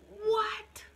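high, loud, exasperated what; incredulous. Acted. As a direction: burst out in mock disbelief. Mock disbelief at the advice.

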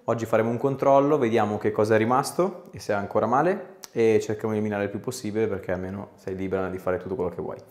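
normal talking voice

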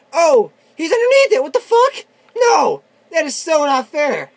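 very high, loud yelling; outraged shouting. Real, not acted. Gamer seeing the enemy dodge under the train, outraged shouting. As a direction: scream your protest at the unfairness.

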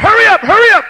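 loud, high call to hurry; Isaac yelling at the diggers, loud and high; urgent shout. Acted. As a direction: yell up from the well to hurry. Urging diggers in the well.

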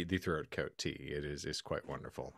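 usual speaking voice

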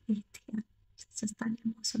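a real swooning whisper; awed whisper. Real, not acted. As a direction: breathe it out like a swooning fan. Swooning over the singer.

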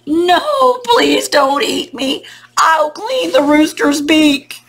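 high, loud, drawn-out plea; hammed-up storybook wail; loud cry. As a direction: cry out slowly and loudly for mercy. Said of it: "the grass cried out very loudly".

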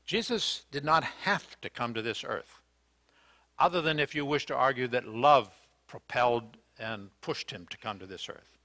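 ordinary delivery

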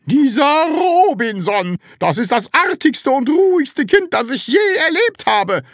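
high, loud ranting voice; full-voiced, high-pitched tirade; loud screaming. A narrator's reading. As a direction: shriek praise as if it were scolding. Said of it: "begann mit lauter Stimme zu schreien".

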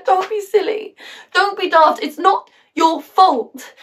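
high-pitched, urgent comforting; insistent reassurance. Acted. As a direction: firmly talk them out of their guilt. Acts friend dismissing mother's guilt.

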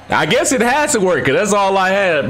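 very high-pitched, excited reasoning; amazed relief. Real, not acted. Still amazed the knife worked.

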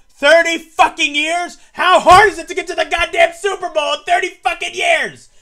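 shouting with rage; furious yelling. Real, not acted. Fan yelling about his team's Super Bowl drought, furious. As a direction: let it all out, real fury. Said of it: "excuse the yelling".

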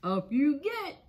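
Up you get with a light laugh in it; Mister Horse laughing to the animals. A narrator's reading. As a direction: say it chuckling, a lightly voiced horse. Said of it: "laughs Mister Horse".

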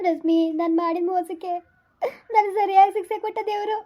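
weep out the confession; tearful. Acted. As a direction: sister sobbing her guilt to Rashmi. Guilt-ridden sister weeping to Rashmi.

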